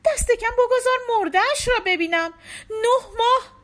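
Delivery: very high, loud crying plea; grieving mother crying; sobbing plea. A narrator's reading. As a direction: break down begging to see the body.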